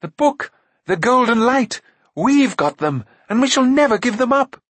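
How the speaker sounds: very high, loud, excited prince; exultant outburst at the top of the voice; triumphant. A narrator's reading. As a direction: crow it in high-pitched triumph. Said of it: "triumphantly faced Akron".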